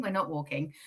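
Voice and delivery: normal talking voice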